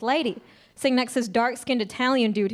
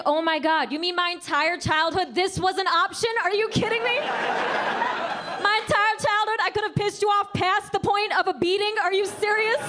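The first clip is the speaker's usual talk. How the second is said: higher, disbelieving rant; incredulous disbelief; 6 semitones higher than usual. Retold or imitated. Comic to the audience about her childhood, incredulous. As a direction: she can't believe what she just heard. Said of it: "I was like".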